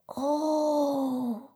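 high, long-drawn gasp of wonder; awestruck, high and held; amazed little koala. Acted. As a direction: let out a drawn-out, marvelling child's oh. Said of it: "staunt der kleine Koala-Junge".

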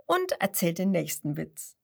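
ordinary delivery, nothing marked

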